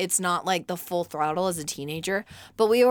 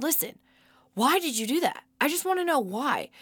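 high-pitched, careful questioning; earnest, raised-pitch retold plea; pleading parent; 8 semitones higher than usual. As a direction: gently press a small child for an answer. Retold parent questioning small child.

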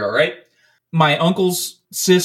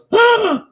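sharp, high, loud intake of breath; shocked gasp; 4 dB louder than usual. Retold or imitated. Girl opening a bloody house door, shocked gasp. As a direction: gasp at the horror inside. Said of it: "opens the door and goes".

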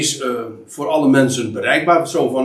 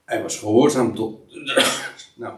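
normal talking voice

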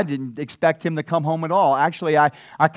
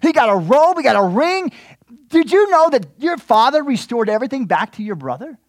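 high, loud breathless news; the servant bursts out with the news; excited servant; 8 semitones higher, 6 dB louder than usual. Retold or imitated. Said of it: "He's like".

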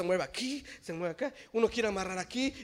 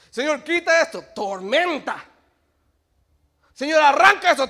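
loud mock pleas; 10 semitones higher, 15 dB louder than usual. Acted pleas and answers.

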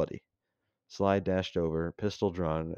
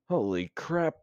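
higher, louder startled yell; 10 semitones higher, 5 dB louder, slower than usual. Acted. Mac yells as something jumps in front, startled yell. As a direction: yell it as the truck hits something. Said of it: "Mac yelled".

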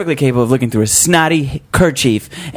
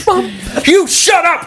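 shouting; slower than usual. Acted. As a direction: shout it at mom. Teen yells at mom.

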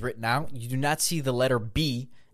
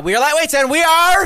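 high, loud show closer; loud sign-off; 12 dB louder than usual. Acted. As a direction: shout the outro hype. Shouted sign-off.

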